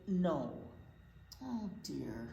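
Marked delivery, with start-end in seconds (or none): none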